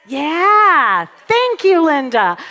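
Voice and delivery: a big, high shout of approval; loud delighted thanks. Real, not acted. Speaker thanks Linda for answering, loud and delighted. Calls out to someone answering her.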